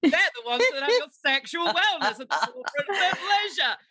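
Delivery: laughing parody of the sponsor line; hammed-up sales pitch, giggling; mock ad voice. Mocking the sponsor plug.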